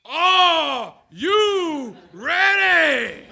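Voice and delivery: slow, high, drawn-out stadium shout; screamed call. Retold or imitated. High school captain screaming to the team, slow and high. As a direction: belt it out to the huddle. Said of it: "the guy who screamed".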